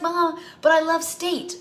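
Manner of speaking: put-on high, slow pleading kid voice; daughter's voice. Daughter pleading with mom about her team, high and slow. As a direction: draw it out like a stubborn kid.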